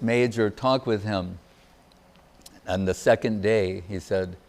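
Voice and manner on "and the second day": normal talking voice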